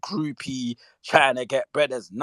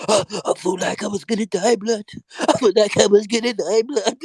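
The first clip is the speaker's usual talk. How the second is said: high, gasping imitation; hammed-up near-death complaint; mock panicked victim; 8 semitones higher, 4 dB louder than usual. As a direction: mimic a panicked man, mockingly. Mimics choked man after gasping.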